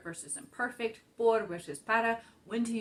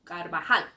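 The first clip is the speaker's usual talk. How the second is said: Carvajal with a loud final syllable, car-va-HAL; stress on last syllable; 2 semitones lower, 7 dB louder than usual. Shown as a demonstration. Teacher concluding the rule, final-syllable stress on Carvajal. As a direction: land hard on the end of Carvajal. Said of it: "stress that last syllable".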